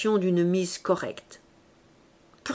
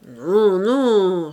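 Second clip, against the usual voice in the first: louder, quick hurt protest; sharp wounded denial; 6 dB louder than usual. A narrator's reading. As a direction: snap back as if stung. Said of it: "s'écria l'architecte vivement et comme blessé".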